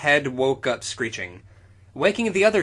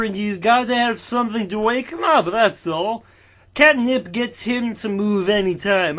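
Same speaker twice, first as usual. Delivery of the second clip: lightly voiced high-pitched dopey giant; a hint of a simple, worried groundskeeper; giant's voice; 8 semitones higher, 4 dB louder than usual. Reading fan fiction aloud, giving Shogred a mild silly voice.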